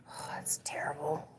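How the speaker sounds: very soft, low whisper; whispered dismay. Real, not acted. Streamer judging their own accent attempt, whispering. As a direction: drop to a whispered self-critique.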